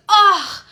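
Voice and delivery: high, loud, drawn-out "oh"; mock a touched, sympathetic "oh". Bartender touched by her offer, imitated high "oh".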